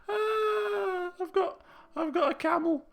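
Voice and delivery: high, tearful laughing voice; helpless laughter. Real, not acted. Player tickled at finding he owns a camel, laughing helplessly. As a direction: wail it through real laughter.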